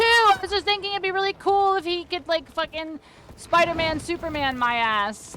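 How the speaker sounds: high, loud sarcastic ranting; loud exasperated rant. Real, not acted. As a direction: rant in disbelief. Loud ranting at monster ability.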